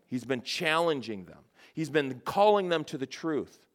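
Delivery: normal talking voice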